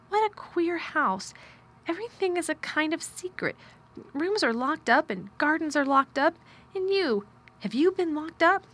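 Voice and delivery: put-on high-pitched, wondering girl's voice; amazed young girl. A narrator's reading. Girl marvelling at the locked-up house, amazed. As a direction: exclaim in astonishment, pitched well up.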